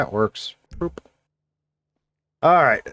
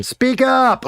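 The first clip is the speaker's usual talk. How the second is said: high, loud call to speak up; calling out loudly; 13 semitones higher, 3 dB louder than usual. Real, not acted. Streamer calling out to his game character, loud and high. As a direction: raise the voice sharply at the character.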